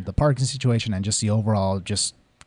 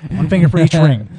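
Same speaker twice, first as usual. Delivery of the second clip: excited; 5 semitones higher, 9 dB louder than usual. Real, not acted. Celebrating Cowboys rings, high five.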